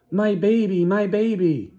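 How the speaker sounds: high, loud gushing cry; doting mother. Acted. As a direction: gush over the baby like a mad mother. Acted mother's mad cry.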